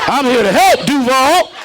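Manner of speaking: high, loud hustler pitch; hyped shout. Acted. Comic hawking T-shirts to the crowd, high and loud. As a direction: sell it like a street hustler. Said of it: "comic repeats line loudly".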